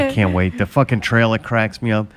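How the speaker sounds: ordinary talk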